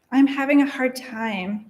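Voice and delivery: ordinary talk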